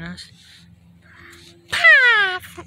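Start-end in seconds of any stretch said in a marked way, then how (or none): 1.7-2.6 very high, loud creature cry; excited Pokemon squeal. Acted. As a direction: squeal it out like a tiny cartoon pet. Creature accepts the invitation.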